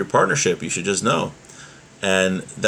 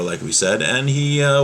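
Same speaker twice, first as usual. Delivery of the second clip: ordinary talk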